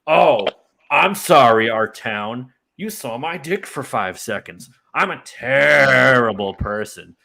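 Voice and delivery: put-on loud, slow slurring drunk voice; drunken rant. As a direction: ham up a sloppy drunk apology. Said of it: "he goes off in a drunken rant".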